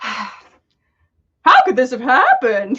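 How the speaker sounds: slow, puzzled question in a thin voice; confused high voice. A narrator's reading. Tom asking Albus, lost, in a slow confused voice. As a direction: ask it in disbelief, drawn out. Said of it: "clear confusion in the high voice".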